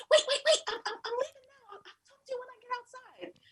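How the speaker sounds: high, rushed whisper; hurried whisper. Retold or imitated. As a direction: whisper fast, sneaking out. Whispering to leave quietly.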